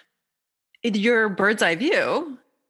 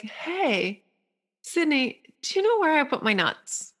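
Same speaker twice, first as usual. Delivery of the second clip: high little squirrel voice; squirrel asking nicely; 8 semitones higher than usual. Acted. Squirrel looking up to ask Sydney, polite request. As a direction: ask for help in a high, small voice.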